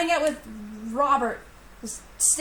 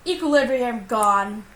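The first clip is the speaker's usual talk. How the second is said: ordinary delivery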